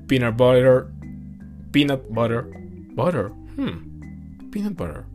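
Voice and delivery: "peanut butter" said with Spanish sounds, like "pino boro"; "peanut butter" with a heavy Spanish accent, "pinot bore"; spanglish. Real, not acted. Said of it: "Disculpa mi Spanglish, muy mal pronunciado".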